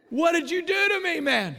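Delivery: loud, high accusing cry; retold outburst. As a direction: demand it at the top of your voice, imitating him.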